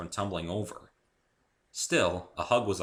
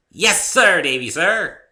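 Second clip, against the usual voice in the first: high, loud playful military reply; mock salute; 10 semitones higher, 15 dB louder, slower than usual. A narrator's reading. Pinkie saluting Davy, playful mock soldier. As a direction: snap off a cheeky salute. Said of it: "Pinkie saluted".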